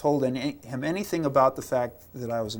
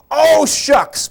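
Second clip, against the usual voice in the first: higher and much louder "Oh, shucks!"; a boy walking away from the soldier, cursing loudly; loud curse; 13 semitones higher, 11 dB louder than usual. Retold or imitated. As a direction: shout it as you storm off. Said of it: "said very loudly".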